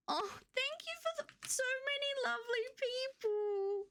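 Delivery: slow, high tearful voice, crying with joy; happy crying. Real, not acted. Streamer thanking chat for birthday gifts, crying happily. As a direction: thank them through happy tears. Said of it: "I haven't had a happy cry in so long".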